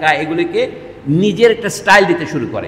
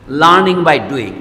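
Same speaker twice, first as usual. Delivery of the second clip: slow deliberate English phrase; 5 dB louder, much slower than usual. Shown as a demonstration. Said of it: "active school বলা যায় ইংরেজিতে".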